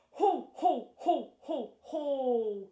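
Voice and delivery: deliberate, punched "ho ho" laugh; loud staged laugh, last one drawn out; low belly laugh. Shown as a demonstration. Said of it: "I'm gonna laugh really low".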